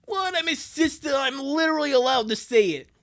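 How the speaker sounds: high, protesting sister voice; indignant older sister. Acted. Sister defending her teasing to boyfriend, indignant. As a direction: defend yourself as a put-out sister.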